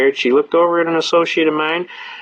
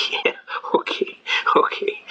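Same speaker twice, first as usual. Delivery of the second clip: laughing whisper; 5 dB quieter than usual. Retold or imitated. Judge gives in, laughing.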